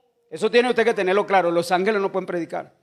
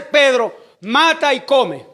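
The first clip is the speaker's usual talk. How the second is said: heavenly command; 5 semitones higher, 8 dB louder, slower than usual. Acted.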